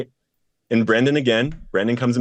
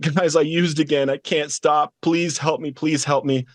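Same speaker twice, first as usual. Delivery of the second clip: higher, mildly pleading retold cry; retold crying plea; 5 semitones higher than usual. Interrupting prayer to beg the group, mild retold crying. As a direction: beg for help, a hint of tears. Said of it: "I'm crying".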